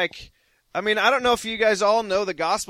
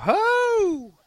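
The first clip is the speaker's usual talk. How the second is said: ecstatic cry. Real, not acted. Charismatic worship outburst.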